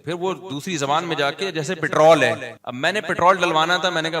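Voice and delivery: usual speaking voice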